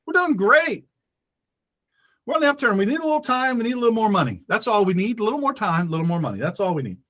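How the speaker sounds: mocking upbeat reassurance, high then dropping; Barack Obama. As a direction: parody a rosy sales pitch, starting way up high. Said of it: "according to Barack Hussein Obama".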